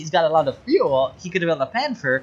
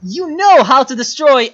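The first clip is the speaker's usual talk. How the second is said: higher and louder, a real frustrated rebuke; louder, higher frustrated scolding; angry scolding; 8 semitones higher, 8 dB louder than usual. Real, not acted. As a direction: raise the voice at the player in real anger. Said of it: "I'm mad at you right now".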